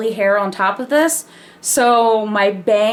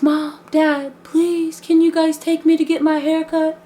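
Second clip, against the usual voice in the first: put-on high whiny begging kid voice; pleading child; 6 semitones higher than usual. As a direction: beg like a little girl. Kid begging parents for haircut.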